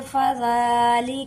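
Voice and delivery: very slow, calm chanted verse; calm recitation. Shown as a demonstration. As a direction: recite peacefully, drawing out the vowels. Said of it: "पुरसुकून तरीके से इसे एक बार अदा करते हैं".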